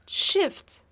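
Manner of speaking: ordinary talk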